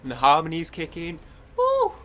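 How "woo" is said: loud falsetto "woo"; short soaring whoop; high woo. Retold or imitated. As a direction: let out a high, loud backing-vocal woo. Imitating the harmonies kicking in.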